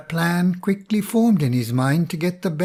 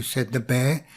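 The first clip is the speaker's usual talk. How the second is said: ordinary delivery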